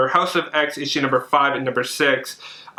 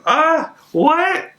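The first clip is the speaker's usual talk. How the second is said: a sudden squeal of delighted disbelief, much higher; 3 dB louder than usual. Real, not acted. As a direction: let out a real, thrilled squeal. Squeals again at the cover.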